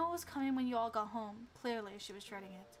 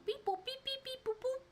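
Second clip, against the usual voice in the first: high electronic beeps; imitated dial tones; 12 semitones higher than usual. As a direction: sing out little keypad boops. Imitates phone dialing.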